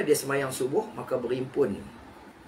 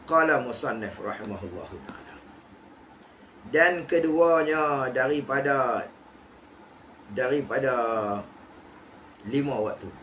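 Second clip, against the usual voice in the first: kitab recitation. Acted. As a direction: intone the book's words to the class. Reads kitab text aloud.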